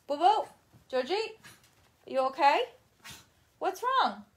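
high, loud cooing to the dog; pet talk. Real, not acted. Owner checking on her dog George, high cooing. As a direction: coo at your pet with a high voice.

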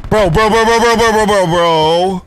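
loud, high rapid-fire repetition; alarmed yelling. Real, not acted. Gamer spotting trouble in the air, alarmed yelling. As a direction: yell the word over and over.

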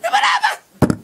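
put-on very high squeaky baby-talk with a laugh; shrill cooing father. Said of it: "רוצה לחבק את הבן שלי".

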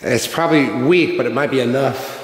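ordinary talk

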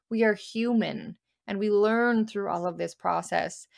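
ordinary talk, nothing marked

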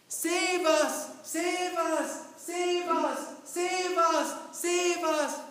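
loud, high desperate cries of Save us!; prisoners shouting. Acted. Preacher voicing prisoners begging the king to save them, desperate shout. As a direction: cry out for rescue at full voice. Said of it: "everybody start to shout again".

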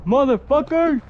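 sing-song threat to the hiders; taunting villain voice. Acted. Seeker's menacing call at start.

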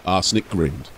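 steady storyteller read of an ork tale; story narration. A narrator's reading. Narrator telling an ork story to listeners, storytelling voice. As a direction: carry the listener through the scene.

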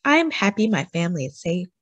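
usual speaking voice

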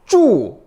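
쭈 falling sharply from high; fourth tone. Shown as a demonstration. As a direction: drop 쭈 fast from the top. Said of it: "사성".

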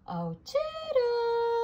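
high, drawn-out singsong call. A narrator's reading. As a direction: call for a helper, stretching it out high. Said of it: "He called".

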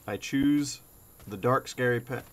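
normal talking voice

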